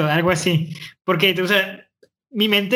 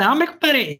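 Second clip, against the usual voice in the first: mock northern Mexican drawl, pitched up; agresivo norteño accent; 7 semitones higher than usual. Host mimicking the accent he says he lacks, exaggerated norteño. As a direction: put on a thick norteño accent, higher-pitched. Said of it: "hay acentos de".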